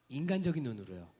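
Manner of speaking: normal talking voice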